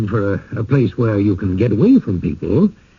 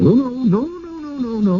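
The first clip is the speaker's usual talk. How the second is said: pitched much higher, tumbling no's; flustered denial; 12 semitones higher, 4 dB quieter than usual. Acted. Host hastily denying exclusion, flustered and high. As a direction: rush to deny it, caught off guard.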